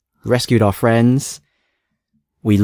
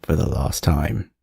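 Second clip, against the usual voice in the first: ominous; 6 semitones lower, 5 dB quieter, slower than usual. Pretend. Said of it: "echo, dramatic doom tone".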